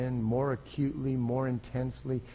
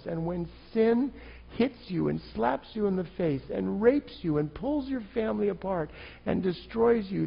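usual speaking voice

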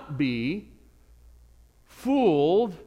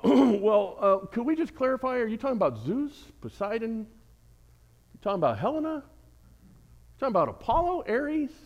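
higher, mock-puzzled questioning; mock clarifying spirit; 5 semitones higher than usual. Preacher mocking the spirit, higher and puzzled. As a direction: play dumb about which god they mean. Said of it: "He didn't say".

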